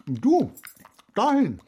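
as if she'd lost her mind; aghast disbelief. Acted. Enzo staring at Annie's wish, aghast and loud.

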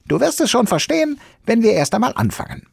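very high, fast squeaky voice; Sams reassuring. Acted. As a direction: brush it off quickly and cheerfully.

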